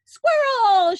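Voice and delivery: high, loud squeal; shouted. A narrator's reading. Veruca Salt shouting at the squirrels, high and loud. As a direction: shriek it with greed. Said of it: "shouted Veruca Salt".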